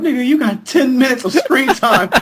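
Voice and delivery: usual speaking voice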